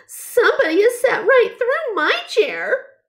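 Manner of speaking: put-on high baby bear cry. A narrator's reading. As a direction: wail like a tiny bear finding his chair broken. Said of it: "cried Baby Bear".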